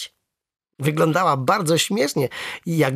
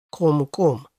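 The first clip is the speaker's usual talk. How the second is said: lightly voiced frog croak; agreeing frog. A narrator's reading. Frog accepts the boy's apology, lightly croaking. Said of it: "zgodziła się żaba".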